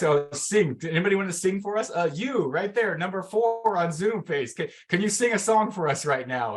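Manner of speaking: high, bright put-on voice calling on people; mock cheery host. Host on a Zoom call asking a participant to sing, high put-on cheer. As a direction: play a host singling someone out to sing. Imitating a host picking singers.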